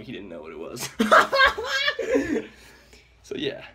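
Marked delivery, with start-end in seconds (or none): 0.9-2.5 loud high laugh. Real, not acted. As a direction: burst of high-pitched laughter. Laughing at sleepy friend.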